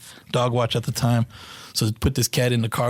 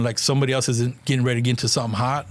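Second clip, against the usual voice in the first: ordinary talk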